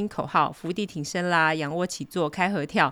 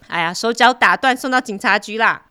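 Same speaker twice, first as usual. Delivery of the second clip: loud threat; 4 semitones higher, 8 dB louder than usual. Retold or imitated. Brother threatening the thief.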